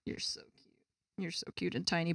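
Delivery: soft whispered cooing; whispered to cat. Real, not acted. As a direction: whisper sweetly to the pet.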